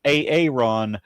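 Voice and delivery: Aaron split up as ay-ay-RON; split double A. Shown as a demonstration. Reading the ancestor's name aloud, joking split pronunciation. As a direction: sound each A of Aaron by name, then RON. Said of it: "they're pronounced separate".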